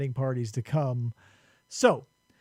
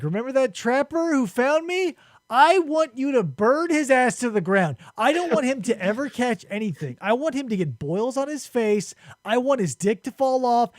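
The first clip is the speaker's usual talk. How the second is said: higher, mock-furious rant; retold angry tirade; 6 semitones higher than usual. Dying Enkidu curses the trapper who found him, mock angry. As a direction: rattle off curses in hammed-up anger. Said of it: "That's how fucking angry Enkidu is".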